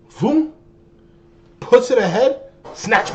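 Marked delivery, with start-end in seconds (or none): 0.1-0.5 high quick voom again for the dribble; vocal whoosh. Retold or imitated. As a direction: mimic the ball snapping by. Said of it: "He has the ball in his hand".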